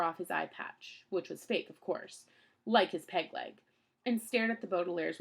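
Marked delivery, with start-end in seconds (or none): none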